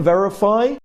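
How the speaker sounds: ordinary talk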